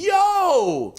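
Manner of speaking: high, stretched "yo" of disbelief; appalled father. Retold or imitated. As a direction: drag it out in mock shock. Acting shocked father.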